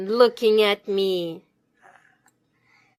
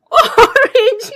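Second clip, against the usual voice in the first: high laughing disbelief; 14 semitones higher, 10 dB louder than usual. Real, not acted. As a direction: laugh out the question, amused. Laughs at child's wrong answer.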